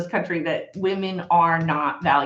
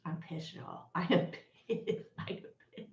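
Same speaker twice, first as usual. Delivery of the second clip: much softer, half-whispered fury; hushed anger; 5 semitones lower, 14 dB quieter than usual. Real, not acted. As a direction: say it under your breath, seething. Furious but suddenly hushed on camera.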